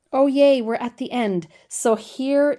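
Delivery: real delight at finishing; excited. Real, not acted. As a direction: cheer that the end is here.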